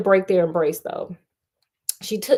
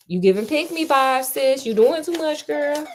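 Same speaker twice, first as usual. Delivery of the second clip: sassy read; 5 semitones higher than usual. Real, not acted. Host reading the heroine, sassy and exasperated. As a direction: roll your eyes and read her. Reader mocks the heroine's neediness.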